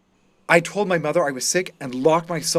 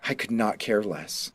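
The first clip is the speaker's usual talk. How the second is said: usual speaking voice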